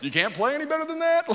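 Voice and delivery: high-pitched competitive trash talk; taunting. Retold or imitated. Elijah taunting the prophets from the sidelines, taunting. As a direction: jeer it at a rival from the sidelines. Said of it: "Elijah begins to taunt them".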